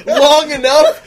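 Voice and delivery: ordinary talk, nothing marked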